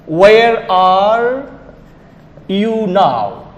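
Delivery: slow "Where are you now" with every R sounded; Bangladeshi pronunciation. Shown as a demonstration. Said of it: "we pronounce it".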